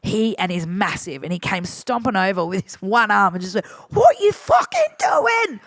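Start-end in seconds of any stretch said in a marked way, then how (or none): 3.9-5.6 high, loud, drawn-out outraged yell; mock angry shout. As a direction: imitate a furious DJ bellowing across the stage. Enraged DJ storms over in retold story.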